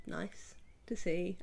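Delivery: slow hoarse whisper. Real, not acted. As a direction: voice fading away. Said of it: "I'm losing my voice a little bit".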